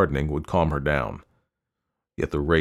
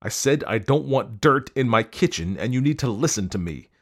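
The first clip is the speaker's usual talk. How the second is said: higher-pitched scolding, easing down; exasperated demand; stern insistence; 6 semitones higher than usual. A narrator's reading. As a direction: lay down the law to your wife. Husband insists in quarrel.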